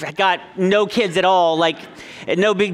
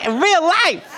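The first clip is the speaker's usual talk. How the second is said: little son's voice; 12 semitones higher, slower than usual. Retold or imitated. Dad retelling his son warning his sister in a high child voice. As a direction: play the small boy warning his sister. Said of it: "he's like".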